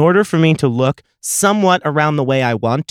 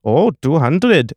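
lightly imitated Indian accent, higher and slow; 4 semitones higher, slower than usual. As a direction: draw it out in a mock Indian accent. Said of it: "she said in her accent".

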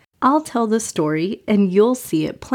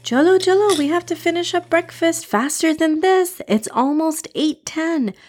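hurried mom; 8 semitones higher than usual. Acted. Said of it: "As Mama says".

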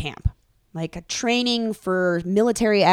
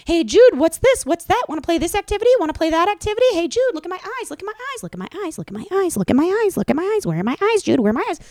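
put-on high, singsong little boy; little kid voice; 11 semitones higher, 5 dB louder, faster than usual. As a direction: pester like a chirpy four-year-old. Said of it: "He just follows Jude around the house like".

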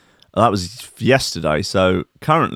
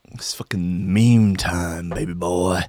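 mock whisper; 4 semitones lower, slower than usual. Hyping the meme segment.

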